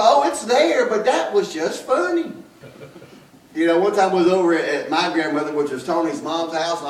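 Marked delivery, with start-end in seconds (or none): 0.0-2.3 much higher, louder mock defense; defensive reply. Preacher answering the church lady, high and louder. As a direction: answer back lightly in a high voice. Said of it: "And well".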